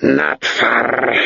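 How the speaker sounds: hoarse, lipless beast trying to speak. A narrator's reading. Possessed guide answers without turning, in a hoarse beastlike voice. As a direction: growl it like a beast forming words. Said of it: "his voice sounded not like a man's voice, but like a beast, hoarse and lipless".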